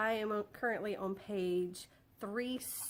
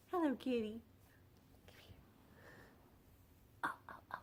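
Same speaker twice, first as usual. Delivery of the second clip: soft kitten call; 2 semitones higher, 8 dB quieter, much faster than usual. Real, not acted. Calling the kitten quietly.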